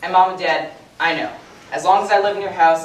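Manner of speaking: ordinary talk, nothing marked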